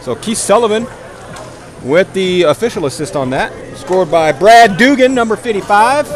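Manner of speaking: lively hockey commentary; play-by-play. Acted. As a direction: call the game as it happens.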